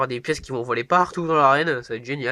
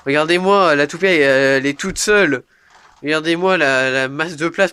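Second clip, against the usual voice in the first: excited; 9 dB louder than usual. Real, not acted. Excited look at spinning top.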